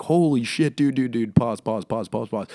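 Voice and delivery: loud, high panicked pleading. Retold or imitated. Narrator pinned by the cop, loud high panic. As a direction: beg him to stop, high and loud.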